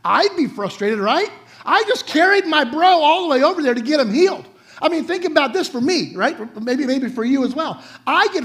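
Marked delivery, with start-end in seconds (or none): 1.6-4.4 mock frustration. Frustrated friend hauling his buddy.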